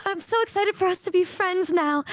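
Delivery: high, gasping mock excitement; gushing excitement. Girl to her new friend in class, imitated gushing excitement. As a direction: imitate an overexcited new friend with a gasp first.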